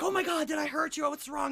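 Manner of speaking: high, loud panicked questions; mock alarm. Joke teller as man running back to crying lady, loud mock alarm. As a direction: rush back in hammed-up alarm, pitched high. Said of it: "so he runs back".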